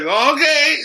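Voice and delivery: high, bright mock welcome; mock cheerful okay. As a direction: act the clueless sage burner cheerfully inviting spirits in. Mocking naive sage burner.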